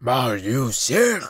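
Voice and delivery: slow, halting beast voice; slow wolf creature. A narrator's reading. False wolf asks the shivering boy, slow and halting. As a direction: ask it slowly like a clumsy creature.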